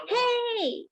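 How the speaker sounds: high, drawn-out shout; shouting hail. Shown as a demonstration. Teacher shows students that hey is like shouting, a high shout. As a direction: shout it to grab someone's attention. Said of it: "it's just like a shouting".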